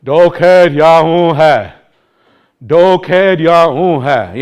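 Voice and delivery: slow, loud rote group recitation; imitating learners chanting in unison; class reciting in chorus. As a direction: drone it slowly and loudly, like a whole class repeating. So all of a sudden, everybody.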